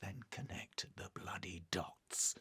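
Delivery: very soft, slow, near-whispered jab; muttered retort. Acted. Officer cut off by superior, muttered retort. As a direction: mutter it slowly under the breath.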